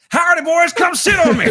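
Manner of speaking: retold Lego Movie chair scene, loud laughing yell; mock shout. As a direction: yell it like a clueless cowboy. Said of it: "he's like".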